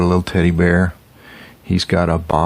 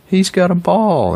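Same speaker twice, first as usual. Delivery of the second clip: excited discovery; 12 semitones higher than usual. Acted. Reading picture book to child.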